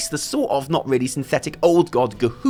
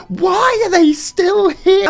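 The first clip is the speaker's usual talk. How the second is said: very high, loud, slow yell; exasperated yell; 13 semitones higher, 7 dB louder, much slower than usual. Acted. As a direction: stretch every word in mock outrage. Repeats question louder, comic escalation.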